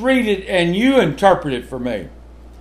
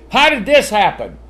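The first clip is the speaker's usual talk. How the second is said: raised scolding parent voice; parent questioning a child; 5 semitones higher, 5 dB louder than usual. Retold or imitated. Parent asking child about messy room, mildly stern. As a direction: demand an answer from a messy kid. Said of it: "what you always ask kids".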